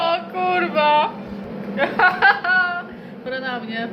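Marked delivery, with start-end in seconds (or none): 0.0-1.1 high, loud, slow exclamation; excited outburst. Real, not acted. Laughing outburst. 1.1-3.2 high giggling laugh. Real, not acted.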